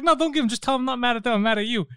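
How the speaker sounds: high, heated retold reply; worked-up customer, pitched high; exasperated retort. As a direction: argue back at the agent, amused. Retells heated reply to agent.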